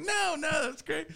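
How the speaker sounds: high, strained "no no, that's great"; high forced politeness covering panic; covering for an awkward moment with a high fake compliment; fake polite. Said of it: "I was like".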